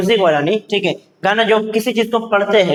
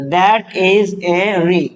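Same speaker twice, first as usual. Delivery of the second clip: very slow, word by word; drawn-out words, evenly spaced; slow reading drill; much slower than usual. Shown as a demonstration. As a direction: model the sentence for beginners, slowly. Teacher models sentence to learners.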